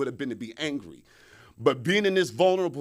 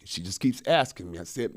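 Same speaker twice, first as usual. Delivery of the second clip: ordinary talk, nothing marked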